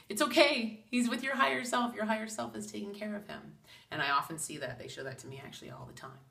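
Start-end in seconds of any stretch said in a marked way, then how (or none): 0.1-3.6 high, gentle comforting voice; reassuring. Retold or imitated. Spirits comforting a grieving pet owner, reassuring. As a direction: soothe her like a grieving child. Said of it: "that was their way of saying".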